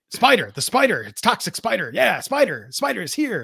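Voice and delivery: high, loud hyped-up commentator parody; hammed-up announcer hype mocking the repetition. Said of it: "if they say Spider or Toxic Spider again".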